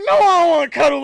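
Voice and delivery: loud, high-pitched mock protest; indignant refusal. Boyfriend retells refusing a cuddle after a quarrel, indignant.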